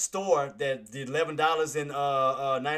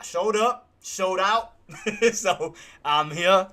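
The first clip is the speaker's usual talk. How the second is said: louder, higher and slow; proud boast; 5 semitones higher, 8 dB louder, much slower than usual. Real, not acted. Telling viewers he showed up, proudly and louder. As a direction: brag it out, stretching it. Boasting he still came through.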